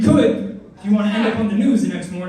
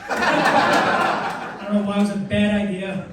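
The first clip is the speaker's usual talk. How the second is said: usual speaking voice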